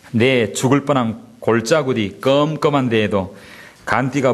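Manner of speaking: slow, folksy Gyeongsang dialect recitation, half laughing. Pretend. Pastor reciting Psalm 23 in Gyeongsang dialect to amuse the congregation, slow and folksy. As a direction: lay on the Gyeongsang accent like a country elder. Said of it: "또 뭐라고 말하고 있냐면".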